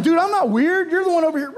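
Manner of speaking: high, loud indignant protest; defensive retort. Retold or imitated. He answers the guy who looked at him: loud indignant retort. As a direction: push back, half laughing. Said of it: "I was like".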